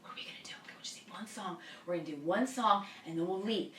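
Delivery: very soft, near-whispered conspiring; hushed plotting. Retold or imitated. Secret plotting in the man's bathroom.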